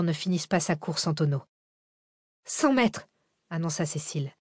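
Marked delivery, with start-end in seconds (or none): 2.4-3.1 high-pitched, tense warning; alarmed countdown. A narrator's reading. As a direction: call out the shrinking distance in alarm. Cécile annonce la distance, paniquée. (annonce alarmée, voix aiguë)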